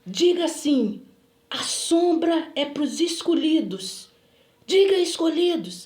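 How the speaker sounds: slow, forceful imitated prophet; commanding proclamation. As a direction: drill the words in like a preacher. Retold man's solemn command.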